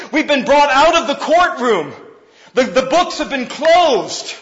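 high, loud, driving sermon voice; preaching at full force. Acted. Pastor preaching grace to church, loud and high. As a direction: preach it out with fire to the congregation.

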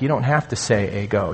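ordinary delivery

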